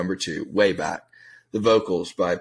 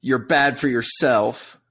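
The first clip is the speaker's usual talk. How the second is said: mocking lyric reading; 7 semitones higher than usual. Friend mocks the song's lyrics to his co-host, sneering. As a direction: read the lyrics to make them sound pathetic. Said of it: "you can't read lyrics like that".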